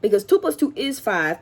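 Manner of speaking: normal talking voice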